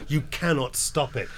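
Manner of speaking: usual speaking voice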